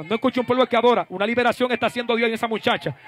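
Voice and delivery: loud, urgent deliverance preaching; full-force preaching. Real, not acted. Preacher urging the congregation during a deliverance, full-force. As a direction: drive it hard with bursts of cries.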